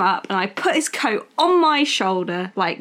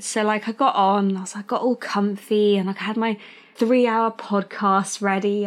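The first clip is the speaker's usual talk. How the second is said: ordinary delivery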